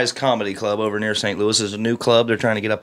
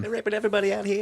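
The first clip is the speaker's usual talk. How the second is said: mock panicked voice; 11 semitones higher, 6 dB quieter, much faster than usual. Mock ghost-attack joke.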